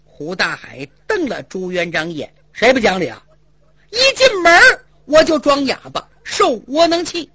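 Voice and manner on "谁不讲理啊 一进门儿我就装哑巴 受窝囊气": aggrieved protest. Acted. Hu Dahai protesting to Zhu Yuanzhang, aggrieved and high. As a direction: vent the pent-up wrongs.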